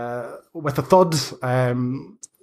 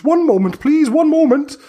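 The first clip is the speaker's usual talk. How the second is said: put-on very high and louder old witch voice; a crone putting them off in a rush; flustered hag; 6 dB louder than usual. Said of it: "She goes".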